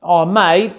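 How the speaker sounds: put-on Aussie greeting, rising at the end; broad Australian matey opener; Australian accent. Shown as a demonstration. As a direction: slip into a casual Australian accent. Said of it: "one that I didn't put on the list is Australian".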